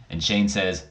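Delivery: usual speaking voice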